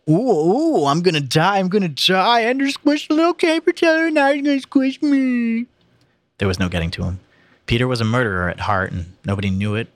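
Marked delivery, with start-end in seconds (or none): 0.1-5.7 high, loud, hammed-up wailing panic; mock terror. Boy ridiculing his little brother's threat, mock terror. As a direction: wail in fake fear, stretching the end.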